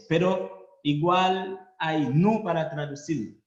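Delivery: ordinary talk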